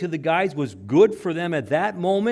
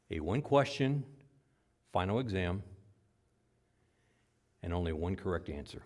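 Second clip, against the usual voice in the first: soft solemn preaching; 7 semitones lower, 6 dB quieter than usual. Real, not acted. Preacher quietly closing his sermon appeal.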